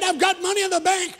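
high, loud boasting; loud brag. Retold or imitated. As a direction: crow about it loudly. Cousin brags about savings.

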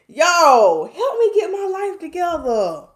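loud high cry for help; excited plea. Real, not acted. Begging the viewers for help, loud high mock plea. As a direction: holler it in mock despair.

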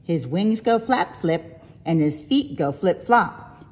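usual speaking voice

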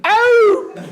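loud, high call of the name; shouting after someone from behind at night; shouted name. Retold or imitated. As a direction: yell the name across the street. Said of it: "diye bir ses duydum".

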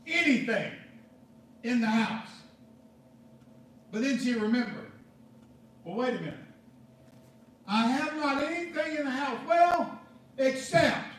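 loud, driving pulpit preaching with hammered words; full-force preaching. Real, not acted. Pastor preaching to the congregation, loud and driving.

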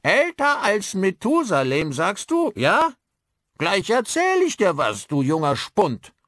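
loud, high mock-indignant old man; high-pitched, loud old-timer retort; old man calling out. A narrator's reading. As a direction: shout back at the cheeky youngster. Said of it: "Plötzlich rief der alte Mann Beeson zu".

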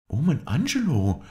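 lady amazed at his passion; cried in wonder. A narrator's reading. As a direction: gasp his name in amazement. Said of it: "rief sie voll Verwunderung".

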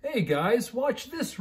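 high excited puppy call; show-off puppy calling to friends; Rufus shouting. A narrator's reading. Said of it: "Rufus shouted".

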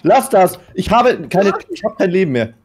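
frantic high yelling while dying; panicking gamer. Real, not acted. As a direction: scream in panic as you lose. Dying to golem, screaming.

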